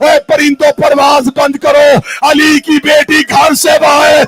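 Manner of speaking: loud, high, shouted sermon climax; full-force wailing preaching; impassioned preaching. Acted. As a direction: cry it out to the heavens.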